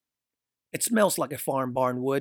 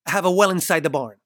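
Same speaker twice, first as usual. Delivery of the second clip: urgent interruption; 4 semitones higher, 6 dB louder, faster than usual. A narrator's reading. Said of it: "Simon quickly interrupts".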